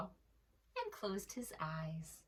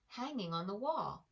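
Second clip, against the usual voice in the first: usual speaking voice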